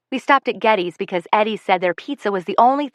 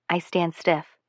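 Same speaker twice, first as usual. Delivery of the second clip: ordinary delivery, nothing marked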